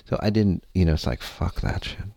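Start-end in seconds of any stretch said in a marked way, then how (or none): 1.2-2.0 whispered curse. Retold or imitated. Giving up in despair.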